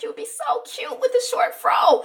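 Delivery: high, sugary mom voice; mother admiring her daughter's new short haircut, cooing high; mother's coo. Retold or imitated. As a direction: gush like a proud mother.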